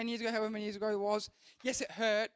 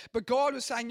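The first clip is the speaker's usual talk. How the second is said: usual speaking voice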